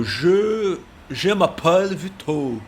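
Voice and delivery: "je m'appelle Vitor" said slowly in French, "m'appelle" run together as one word; slow French "je m'appelle", the "me" linked straight into "appelle"; French liaison demo. Shown as a demonstration. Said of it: "Aí você já vê que juntou tudo ali".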